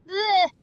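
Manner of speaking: loud, high gagging noise; retching blech. Acted. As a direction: fake a disgusted retch. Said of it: "puking and being disgusted".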